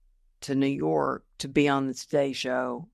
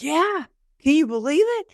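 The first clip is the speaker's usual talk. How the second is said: much higher, thrilled and a bit louder; excited; 10 semitones higher, 5 dB louder than usual. Retold or imitated. Answering her husband, excited and much higher. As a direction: burst out with delighted disbelief.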